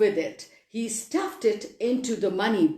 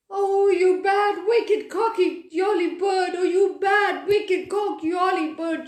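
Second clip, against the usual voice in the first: raging chorus of toys scolding the bird; shouting in a rage; 8 semitones higher than usual. Acted. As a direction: yell it out, furious toys. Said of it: "shouted everyone in a rage".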